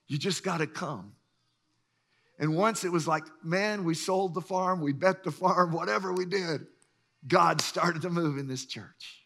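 ordinary delivery

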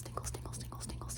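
ordinary delivery

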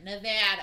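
Nevada with a flat nasal short a, said firmly; Midwestern Nevada. Shown as a demonstration. Asked to say it like a Midwesterner, she gives a firm flat Nevada. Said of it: "Say it like a Midwesterner".

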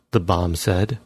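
usual speaking voice

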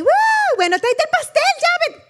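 high, loud mock cheer; party whoop. Relatives cheering for cake right after the prayer, loud and giddy. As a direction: whoop and call out shrilly for cake, imitating.

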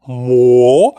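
loud, clipped mo; loud surprised mo. Shown as a demonstration. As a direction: burst out at the sight.

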